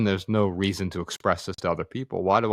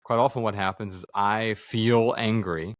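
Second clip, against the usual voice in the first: normal talking voice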